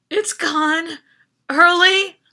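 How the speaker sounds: rising into stunned alarm; shocked. A narrator's reading. Boy learning the lift left early, loud shocked disbelief.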